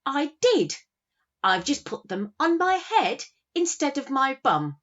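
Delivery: high, loud, proud little lad; grinning cheeky boy. Retold or imitated. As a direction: protest like a pleased small son. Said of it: "he grins and he says".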